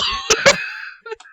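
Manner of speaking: high, wheezing laugh; crying laugh. Real, not acted. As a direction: laugh till it squeaks. Laughing after betrayal.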